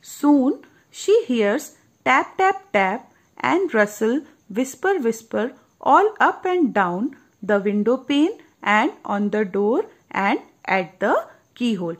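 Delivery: very slow, lilting read-aloud; storybook narration. A narrator's reading. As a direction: let the sounds of the story unfold. Reads storybook passage slowly. (धीमी, लय भरी कहानी सुनाना)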